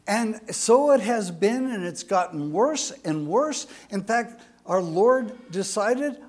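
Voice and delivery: ordinary delivery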